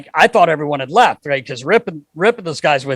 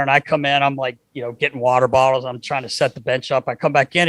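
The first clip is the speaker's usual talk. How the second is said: usual speaking voice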